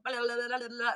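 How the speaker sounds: rapid, rolling nonsense syllables; auctioneer filler babble. Shown as a demonstration. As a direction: babble the in-between patter like an auctioneer. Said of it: "thing in the ins-, in between".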